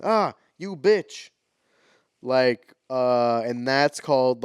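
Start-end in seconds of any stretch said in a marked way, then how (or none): 0.0-1.3 slow, high mock child voice; quoting a girl's line. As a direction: drag it out in a high kid voice.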